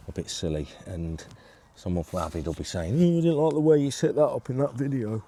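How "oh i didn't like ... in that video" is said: moaning Yorkshire voice, higher and louder; Yorkshire accent. Retold or imitated. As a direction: whinge it like a grumpy Yorkshireman. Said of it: "I always do these Yorkshire accents when I imitate somebody".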